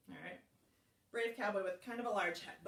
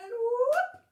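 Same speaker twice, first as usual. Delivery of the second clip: high, loud whoop as the book shuts; excited whoop; 12 semitones higher, 11 dB louder than usual. Shown as a demonstration. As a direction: yelp it out big and high. Book snapping shut in song.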